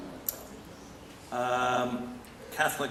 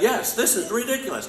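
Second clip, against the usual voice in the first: "this is ridiculous" said much higher and a bit louder, as a model reply; prompted crowd answer; 10 semitones higher, 3 dB louder than usual. Shown as a demonstration. Teacher to audience, a lively model reply. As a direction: give the crowd the answer to shout back. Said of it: "You should all say".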